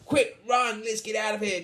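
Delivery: high, loud urgent call; panicked cry. A narrator's reading. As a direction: cry out like fleeing storybook thieves. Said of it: "cried the thieves".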